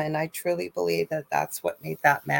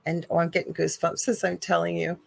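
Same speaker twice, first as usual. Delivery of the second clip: ordinary delivery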